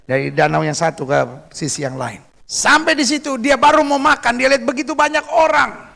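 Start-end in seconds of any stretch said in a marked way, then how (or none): 2.5-5.9 high, emphatic sermon storytelling; forceful preaching. Real, not acted. Preacher telling how Jesus met the crowd, forceful preaching. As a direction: lift the story high for the congregation.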